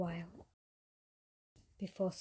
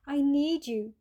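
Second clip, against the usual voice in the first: urgent plea; 5 semitones higher, 8 dB louder than usual. A narrator's reading. Cat pleads with hairdresser.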